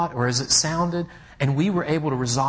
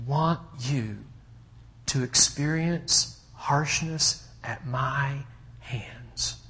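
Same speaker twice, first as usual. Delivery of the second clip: sincere apology; 5 dB quieter, slower than usual. Retold or imitated. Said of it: "I said to Thomas".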